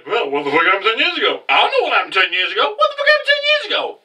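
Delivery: fast babble of bewildered voices, climbing very high; mock panicked confusion. People going on about ten years ago, mock panic. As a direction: imitate a clueless crowd, rapid and squeakier each time.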